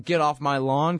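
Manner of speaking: ordinary talk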